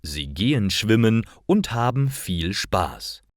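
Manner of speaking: ordinary talk, nothing marked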